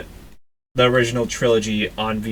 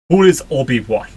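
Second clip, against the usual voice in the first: hammy attempt at a Russian accent; badly faked Russian voice; 3 semitones higher, 5 dB louder than usual. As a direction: put on a clumsy Russian accent, a bit louder. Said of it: "I can't do a Russian accent".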